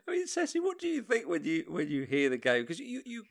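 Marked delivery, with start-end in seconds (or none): none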